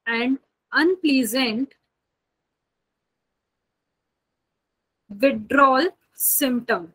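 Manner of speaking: slow, spaced-out dictation with long pauses. Acted. Teacher dictating a definition to her students, slow and spaced. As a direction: spell the definition out word by word for note-taking.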